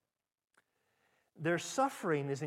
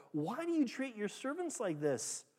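much higher, pleading cry; desperate plea; 10 semitones higher than usual. Acted. Israel's foremen crying out to Pharaoh, high desperate pleading. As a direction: beg the king for mercy. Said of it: "came and cried to Pharaoh".

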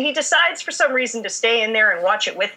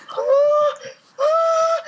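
keening noises. Retold or imitated. Overcome by the kiss on TV, she lets out high keening wails. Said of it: "making these keening noises".

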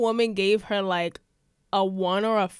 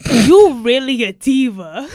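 loud, slow imitated admiring drawl; hammed-up sassy compliment; sassy diva voice; 3 semitones higher, 14 dB louder, slower than usual. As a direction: draw it out grandly like a hype woman. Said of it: "she was like".

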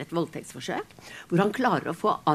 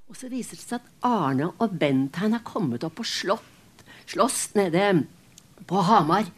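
ordinary delivery

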